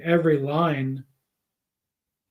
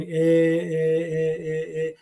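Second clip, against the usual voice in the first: drawn-out sung "e, e, e"; slow hummed "e" sounds, like searching for words; hesitation filler; much slower than usual. Shown as a demonstration. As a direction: imitate someone stalling while thinking. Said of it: "naturally start going".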